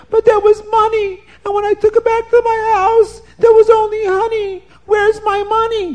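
high, loud woman's voice demanding her money back; shrill it out as the cheated woman; upset woman. A narrator's reading.

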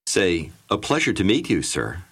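ordinary talk, nothing marked

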